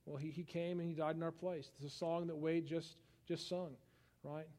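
usual speaking voice

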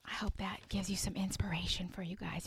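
soft whispered close; gentle whispered inspiration; hushed whisper. Real, not acted. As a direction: let the words drop to a whisper. Hushed closing thought.

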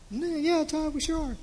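high-pitched put-on voice of an eager crowd; mock audience reply. Speaker answers his own question for the congregation, mock audience reply.

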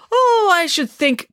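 high, slow musing; high drawn-out start. Acted. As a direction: stretch it out high and thoughtful. Nanny begins, interrupted.

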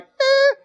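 high-pitched, wordless noise of indifference; mock unimpressed "eh". Preacher imitating Pharisees hearing Jesus's story, high mock "eh". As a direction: squeak out a dismissive little "eh". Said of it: "the Pharisees like".

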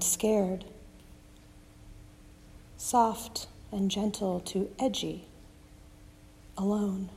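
quiet, tender repeated phrase; soft and gentle. Shown as a demonstration. Preacher calming the frightened, soft and gentle. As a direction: soothe each fear in turn. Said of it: "Soft and gentle, that's what I like".

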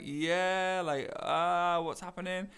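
awkwardly unsure who this is; a bit hesitant. Retold or imitated. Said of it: "a bit hesitant, like".

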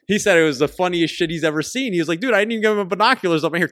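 high laughing voice, fast. Real, not acted. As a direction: laugh while retelling it. Laughing retelling the kill.

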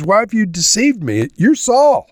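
rising high, alarmed cry of betrayal; a woman suddenly seeing through the disguise; shocked accusation. Acted. Woman realises she faces Saul.

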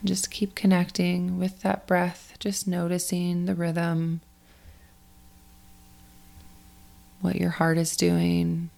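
guided meditation. Acted. Coach guides athletes to let go of last week, in a guided meditation voice. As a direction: ease listeners through the breathing, unhurried and spacious.

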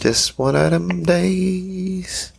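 slow, stretched, sing-song echo; drawn-out echo. Real, not acted. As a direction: drag out each word. Echoing friend's line, drawn out.

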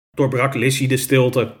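ordinary delivery, nothing marked

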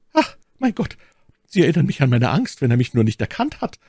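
alarmed maid, high at first; ängstliche Ketty. Acted. (ängstliche, erhöhte Mädchenstimme)

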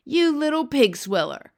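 loud, high-pitched giant's roar; roaring insult. A narrator's reading. Blood bottler insults the B F G, roaring. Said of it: "roared the blood bottler".

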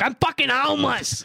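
high, drawn-out mock shout; hammed-up protesting yell; exasperated homeless man. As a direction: imitate a man shouting back at cops.

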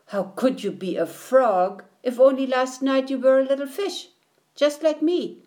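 high, quick indignant protest. Acted. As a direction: argue back, incredulous. Minnow argues with tadpole.

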